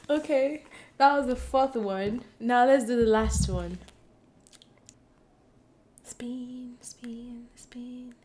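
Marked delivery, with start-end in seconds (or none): 6.0-8.1 breathy whispered chant; hushed whisper while the wheel spins. Real, not acted. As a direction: whisper it like a spell.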